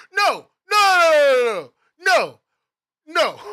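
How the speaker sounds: loud, drawn-out howls of refusal; real angry yell. Real, not acted. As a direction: yell it high, letting it drop off. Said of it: "heard me yell".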